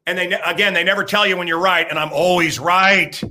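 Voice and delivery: ordinary delivery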